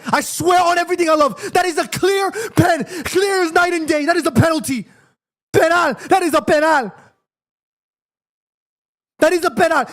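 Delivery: high, keyed-up outcry that it's a penalty; excited insistence. Real, not acted. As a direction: plead the case at the top of your voice.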